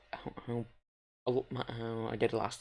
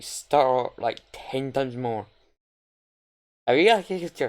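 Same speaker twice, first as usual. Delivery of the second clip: excited; 8 dB louder than usual. Real, not acted. Excited about Japanese dub.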